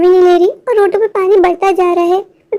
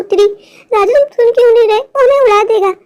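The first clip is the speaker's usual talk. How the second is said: ordinary talk, nothing marked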